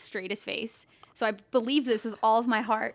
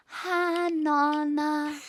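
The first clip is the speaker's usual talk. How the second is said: high, drawn-out mock Italian greeting; singsong Italian-accented hello; imitated Italian accent; 4 semitones higher, much slower than usual. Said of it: "[name]'s talking in an Italian accent".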